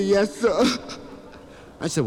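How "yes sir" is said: mock-tearful little boy; acted sniffly child; crying kid. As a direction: answer through put-on sobs. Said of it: "He was crying".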